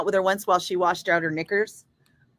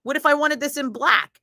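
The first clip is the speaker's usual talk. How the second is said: high, sarcastic put-on shopper voice; fake-polite jab at the fancy store, pitched way up; mock sarcastic shopper; 8 semitones higher than usual. Shopper mocking a fancy store clerk, sarcastic high voice. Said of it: "and you're like".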